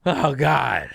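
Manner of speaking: high, loud groan of dismay; drawn-out exasperated "oh God"; mock anguished groan. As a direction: groan like a beaten fan. Acts out crowd being choked out.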